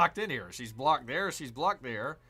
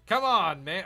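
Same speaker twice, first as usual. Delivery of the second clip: loud, high frustrated plea; exasperated shout; 7 semitones higher, 12 dB louder than usual. Real, not acted. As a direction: shout at a stubborn game unit. Frustrated that unit won't fire.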